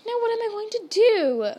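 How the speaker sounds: high, loud wail of dismay; shrill, panicky storybook question. A narrator's reading. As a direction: ask it shrilly, pitched right up. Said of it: "she asked shrilly".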